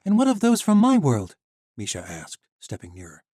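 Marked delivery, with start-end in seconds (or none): 0.1-1.4 put-on high, loud young heroine; defiant young woman. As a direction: stand up to the guard. Misha steps nearer.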